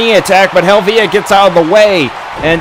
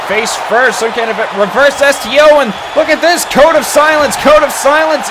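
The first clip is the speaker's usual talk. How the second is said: hyped commentator shout; 7 semitones higher than usual. Real, not acted. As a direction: shout the move name out. Calls a finishing move hyped.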